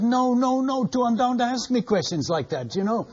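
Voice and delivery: high, pleading no no no; playing the rattled old man who cannot answer; flustered old man. Old man refusing hard questions.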